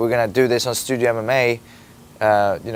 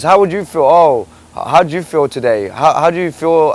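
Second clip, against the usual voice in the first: louder, exasperated questioning; irritated, mocking challenge; heated retort; 4 semitones higher, 6 dB louder than usual. Real, not acted. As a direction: fire questions back at the interviewer. Angrily turns questions back on interviewer.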